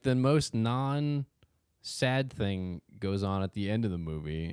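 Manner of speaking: ordinary talk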